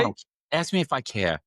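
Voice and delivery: imitating his mother's North Jersey brush-off; North Jersey mom. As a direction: toss it off like a North Jersey mother who doesn't care. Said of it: "That North Jersey accent".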